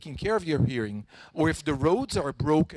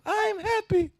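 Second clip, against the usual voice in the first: bright, much higher happy voice; 3 dB louder, slower than usual. Shown as a demonstration. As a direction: sound delighted on it. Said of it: "the tone of voice".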